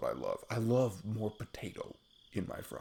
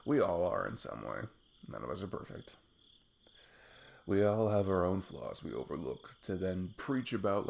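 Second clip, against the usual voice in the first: ordinary talk, nothing marked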